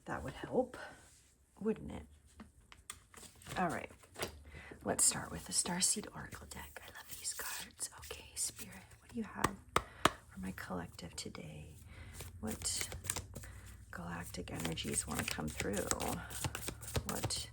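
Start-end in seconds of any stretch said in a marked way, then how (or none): 4.8-15.8 gently whispered, asking Spirit; soft whisper. Acted. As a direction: whisper the invocation to the cards. Whispered card-pull invocation.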